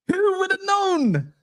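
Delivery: sarcastic sing-song; mock surprise. Shown as a demonstration. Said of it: "mock surprise, higher".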